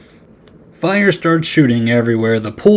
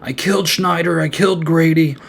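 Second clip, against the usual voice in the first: panicked confession, pitched up high; frantic; 5 semitones higher than usual. Retold or imitated. Jesse confessing to Lisa in a high, frantic panic. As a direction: blurt it out in a panic. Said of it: "goes full-on frantic".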